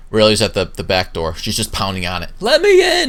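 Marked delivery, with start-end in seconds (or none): 2.4-3.1 very high, loud yell; shrieked demand, acted in full; demanding shout. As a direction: shout it while pounding on a locked door. Character pounding on a locked door.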